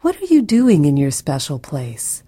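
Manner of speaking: ordinary delivery, nothing marked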